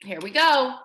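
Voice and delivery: usual speaking voice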